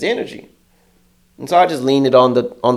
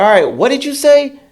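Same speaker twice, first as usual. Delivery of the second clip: baiting challenge; 12 semitones higher, 3 dB louder than usual. Retold or imitated. Baiting coworker on speakerphone.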